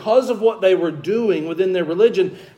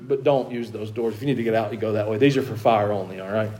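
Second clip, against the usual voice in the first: a low, softer practical aside to the room; quiet aside; 8 semitones lower, 7 dB quieter, faster than usual. Real, not acted. Pastor quietly pointing out the exits, soft aside. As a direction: drop your voice for a quick housekeeping note. Aside about the fire doors.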